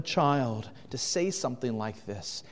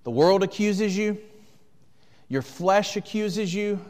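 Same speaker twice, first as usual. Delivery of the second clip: louder and higher, driving; full-force preaching; 6 semitones higher, 5 dB louder than usual. Acted. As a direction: preach it to the back row.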